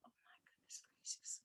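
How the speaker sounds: breathless whisper of dismay; whispered aside. Retold or imitated. Passenger whispering in shock at the driver, hushed. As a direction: whisper it under your breath, stunned. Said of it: "And I said".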